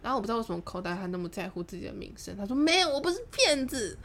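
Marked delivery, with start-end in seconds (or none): 2.6-4.0 high, wailing mock denial; whiny protest. Man denying he is a liar, whiny protest. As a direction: plead it loudly, pitched up and hammed.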